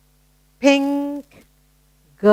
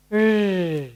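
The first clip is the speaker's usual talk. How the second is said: puppy growl; 2 semitones lower, 5 dB quieter than usual. Shown as a demonstration. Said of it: "remember the puppy pulling the rag".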